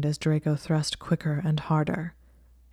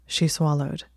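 ordinary delivery, nothing marked

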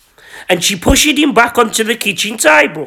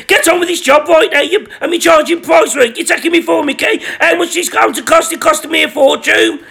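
higher, fast flustered rant; agitated; 6 semitones higher, faster than usual. Acted. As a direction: blurt it out in a fluster. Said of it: "Gary said".

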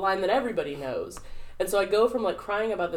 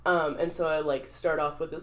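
normal talking voice

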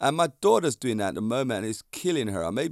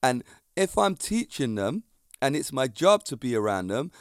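usual speaking voice

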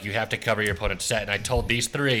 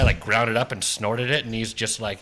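ordinary talk, nothing marked

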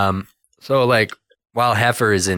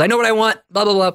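pushy Heifer voice; 12 semitones higher, faster than usual. Retold or imitated. Heifer cutting in to order.

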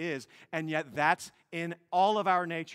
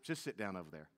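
usual speaking voice

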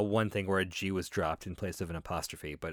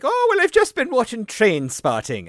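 high, loud mock Scottish accent; fake Scottish accent; 12 semitones higher, 10 dB louder than usual. Friend explaining he watched Trainspotting, loud mock Scottish accent. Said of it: "what's going on with the accent".

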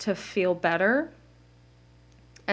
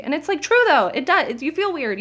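excited outburst; 7 semitones higher, 6 dB louder than usual. Real, not acted. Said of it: "I just screamed".

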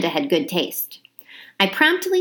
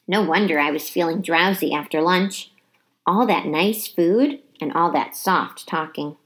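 usual speaking voice

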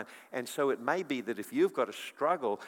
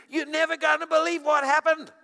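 put-on high, loud excited child voice; bubbling over like a thrilled nine-year-old; a girl rushing to tell her dad; excited daughter; 10 dB louder than usual.

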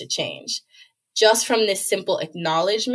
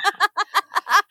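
high laugh; 12 semitones higher than usual. Real, not acted. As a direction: burst of high-pitched laughter. Laughs at hives joke.

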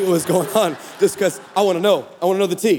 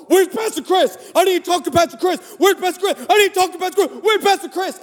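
much higher, frantic woman's cry; hysterical woman; 9 semitones higher than usual. Retold or imitated. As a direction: play a frantic woman demanding the pastor. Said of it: "a hysterical woman".

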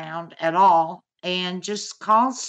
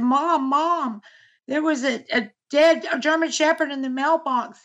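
higher, frightened daughter on the phone, lightly imitated; mimicking daughter's panic; 6 semitones higher than usual. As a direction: relay the girl's terrified call, mildly acted. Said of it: "screaming, crying".

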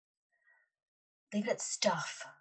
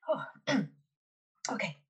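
ordinary talk, nothing marked

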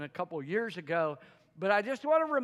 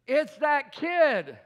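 mock exasperation; 5 semitones higher, 6 dB louder, slower than usual. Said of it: "I, I, I mean".

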